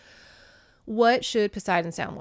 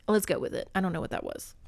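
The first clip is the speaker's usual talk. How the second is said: ordinary delivery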